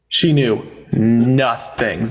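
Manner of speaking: slow and deliberate, each word weighed; slow emphasis. Real, not acted. Host repeating the point slowly to the chat. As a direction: spell it out slowly for the slow ones.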